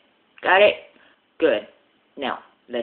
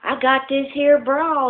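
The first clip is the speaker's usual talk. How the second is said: woman's voice; 10 semitones higher, 4 dB louder than usual. Acted. Blonde woman voiced in joke.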